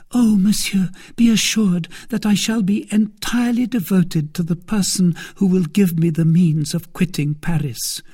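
high, loud, fervent maid's voice; earnest maid. Acted. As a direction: pledge devotion eagerly, pitched high. Voices Kitty grateful and pleading.

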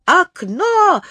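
high, sharp panic cry; alarmed shout. A narrator's reading. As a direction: yell it as an urgent warning. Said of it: "закричал Незнайка".

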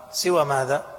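ordinary talk

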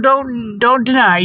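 ordinary talk

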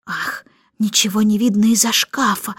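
ordinary delivery

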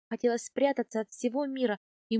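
plain reading voice

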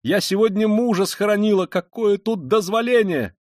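high, loud wail of indignation; outraged yell. A narrator's reading. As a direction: protest loudly, stretching the end. Завопила Катерина Ивановна. (возмущённо вопить)

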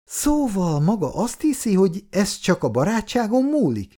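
a woman's temper boiling over as she tells him off; indignant anger. A narrator's reading. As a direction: flare up in offended anger. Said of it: "Margitot elfutotta a méreg".

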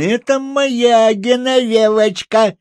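высокий протяжный вопль девочки; реви высоко, не уступая игрушку; капризный рёв. A narrator's reading. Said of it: "Вдруг заревела она". (капризный детский вой нараспев)

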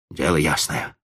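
a touch of grim spite; grim and spiteful. Acted. Boy repeating the old man's words, grimly. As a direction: mutter it darkly. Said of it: "мрачно и со злобой повторил Сергей".

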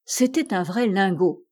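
usual speaking voice

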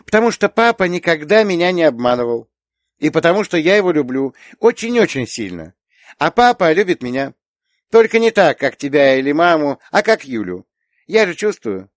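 put-on small girl explaining firmly, pitched high; earnest little girl. A narrator's reading. Little girl explains papa's love to aunt, earnest.

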